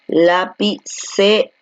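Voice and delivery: "Lapicero" said slowly as la-pi-ce-ro; slow syllable split. Shown as a demonstration. Teacher slowly spells out "Lapicero" syllable by syllable for students. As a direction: pull "Lapicero" apart, one slow syllable at a time. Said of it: "Entonces la separamos de esa manera".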